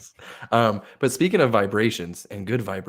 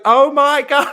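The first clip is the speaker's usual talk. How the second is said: high, loud mock shriek; imitating shocked guest; 12 dB louder, slower than usual. Guest on the phone to front desk, shocked squeal.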